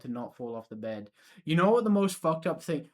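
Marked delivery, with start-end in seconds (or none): 1.4-2.9 excited. Real, not acted. Sudden outburst.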